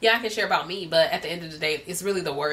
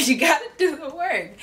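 laughing; 9 semitones higher, 6 dB louder than usual. Real, not acted. Laughing while urging viewers.

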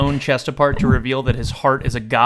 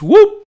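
loud rising "whoop"; comic swooping whoop; imitated whoosh upward; 8 dB louder than usual. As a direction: voice a quick beam-up noise, sweeping upward. Imitates being beamed up.

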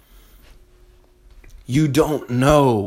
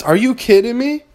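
incredulous; 11 semitones higher, 3 dB louder than usual. Real, not acted. Mocks his own domestic routine.

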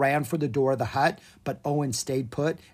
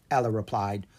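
plain reading voice

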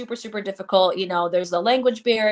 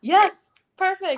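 excited; 11 semitones higher, 5 dB louder, slower than usual. Real, not acted. Connection fixed, relieved reply.

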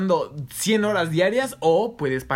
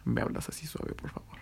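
whispered and much softer; showing how to talk sweetly on Tinder, whispered; 4 semitones lower, 12 dB quieter than usual. Shown as a demonstration. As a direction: breathe it out gently. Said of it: "tienes que hablar así, suave".

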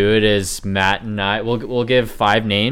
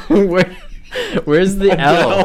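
laughing question; 9 semitones higher, much slower than usual. Real, not acted. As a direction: ask it while laughing. Laughing at the mispronunciation.

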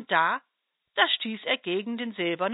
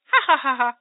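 high, louder teasing giggle; giggling; 4 semitones higher, 8 dB louder, faster than usual. Acted. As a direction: giggle like a cheeky little butterfly. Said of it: "Der kleine Falter kicherte wieder".

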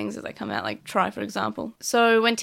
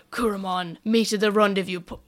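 Diaboromon voice. Retold or imitated. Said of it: "sounds exactly like Diaboromon".

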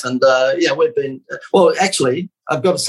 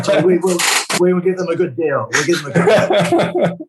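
high, laughing mock correction; sarcastic backpedal; 6 semitones higher than usual. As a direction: cover the joke with a grin. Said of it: "That's that sarcasm coming back".

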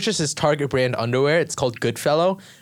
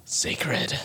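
reverent whisper; 2 semitones lower, 7 dB quieter than usual. Retold or imitated. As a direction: breathe it like a secret. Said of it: "your system's like".

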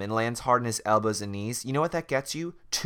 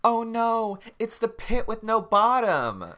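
high mock scream; 11 semitones higher, slower than usual. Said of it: "screams out loud the phrase".

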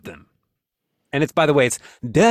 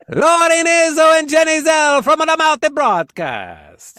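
slow, high, louder guest announcement; announcer intro; 11 semitones higher, 7 dB louder, slower than usual. Acted. Host presenting the returning guests, announcer style. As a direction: present them like a ring announcer.

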